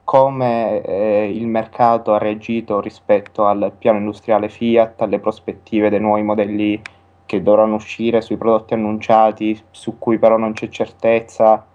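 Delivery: ordinary delivery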